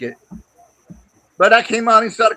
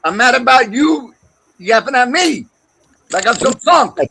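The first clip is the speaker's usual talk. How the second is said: loud, higher, aggrieved yelling; angry shouting; 6 semitones higher, 5 dB louder than usual. Real, not acted. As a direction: yell back, offended. Angry complaint at heckler.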